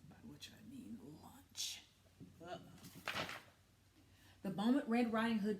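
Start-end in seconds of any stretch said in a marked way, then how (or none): 0.1-2.6 sly whispered aside. Acted. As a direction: let the hunger slip out quietly.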